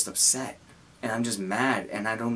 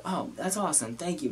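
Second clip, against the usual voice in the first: light, breezy, higher thanks like it's nothing; playing it off casually; 7 semitones higher, 5 dB quieter than usual. Streamer brushing off a donation, casual and light. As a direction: toss off the thanks as if it's no big deal. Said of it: "I have to play it off like it's nothing".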